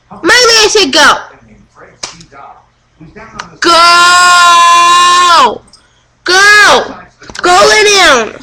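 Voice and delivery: loud firm commands to the dog. Real, not acted. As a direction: order the dog off, loudly. Ordering dog away.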